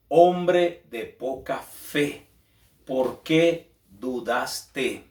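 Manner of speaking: drawn-out, each word weighed; very slow rebuke. Retold or imitated. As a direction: let the rebuke sink in, very slowly. Repeats Jesus's rebuke.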